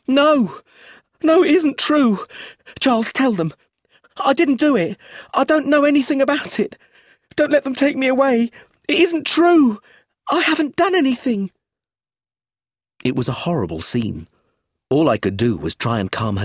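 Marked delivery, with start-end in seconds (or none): 0.0-11.5 hysterical, very high loud denials; frantic high-pitched shrieked pleading; screaming. A narrator's reading. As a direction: scream in panic, losing all control. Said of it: "held on to me and screamed".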